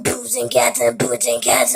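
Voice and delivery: whispered boots and cats beat; breathy rhythmic beatbox syllables; beatbox pattern. Shown as a demonstration. Kid showing camera the beatbox he learned. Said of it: "and then I did".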